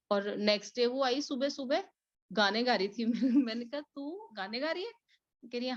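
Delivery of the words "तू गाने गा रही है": high, soft amused disbelief; surprised question. Retold or imitated. Asking the cheerful maid about her singing, surprised. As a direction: ask as if you can't believe she's singing.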